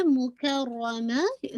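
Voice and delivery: मुकर्रमा slow, with a doubled heavy r; heavy raa. Shown as a demonstration. Teacher models the word, slow recitation. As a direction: stretch मुकर्रमा, leaning into the raa. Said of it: "रा को आप heavy करेंगे".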